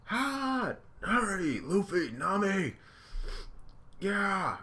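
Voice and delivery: high frantic cartoon yells; high, shrill-pitched cartoon screaming; panicked crew. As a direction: squeal each word in a panic. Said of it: "they're all like".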